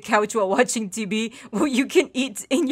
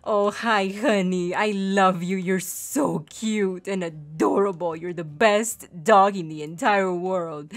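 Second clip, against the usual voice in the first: low, slow cooing; doting pet talk; 4 semitones lower than usual. Real, not acted. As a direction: gush tenderly over a beloved dog. Cooing love to the pet dog.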